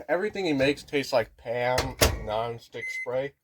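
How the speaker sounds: ordinary talk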